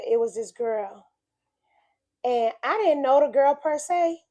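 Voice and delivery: ordinary talk, nothing marked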